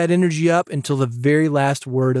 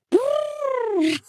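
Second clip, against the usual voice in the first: amused laugh; 3 dB quieter than usual. Real, not acted. Laughing at the lip trill.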